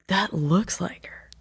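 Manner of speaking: soft, slow, breathless hush; stunned quiet whisper to herself; awed whisper. Retold or imitated. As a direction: whisper it in disbelief. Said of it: "I was like".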